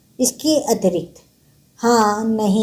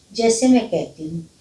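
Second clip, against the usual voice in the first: ordinary talk, nothing marked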